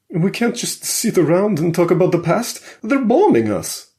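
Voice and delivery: high, loud and panicked; scared protest, voice climbing; quivering with fear. Acted. Alexei urging the group to stop talking, panicked and high. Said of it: "his voice quivering with fear".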